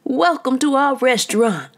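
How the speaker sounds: high, loud hearty welcome; shouted welcome. A narrator's reading. Pierre welcoming diners, shouting. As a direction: greet the room proudly. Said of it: "Pierre shouted".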